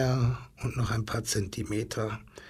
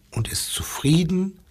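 ordinary delivery, nothing marked

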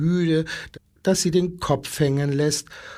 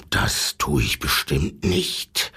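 much lower, snarled refusal; bare the teeth and growl it low; snarling wolf; 12 semitones lower than usual. A narrator's reading. Old wolf refusing the giraffe, low snarl. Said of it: "fletscht der Wolf".

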